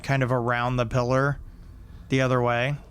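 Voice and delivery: ordinary talk, nothing marked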